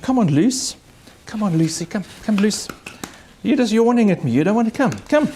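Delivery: high coaxing call to a dog. Real, not acted. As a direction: coax the dachshund over, voice way up. Calling the dachshund over.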